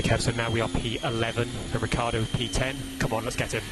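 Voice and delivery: normal talking voice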